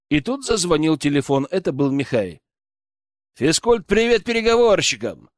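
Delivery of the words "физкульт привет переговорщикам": bright, higher cheerful greeting; cheerful exclaim. A narrator's reading. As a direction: call out a cheery hello. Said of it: "воскликнул я".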